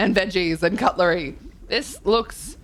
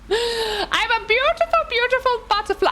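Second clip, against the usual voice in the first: high, laughing mock pride; giggly butterfly. Streamer joking with chat about being a hippo, giggling high. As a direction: giggle through a silly boast.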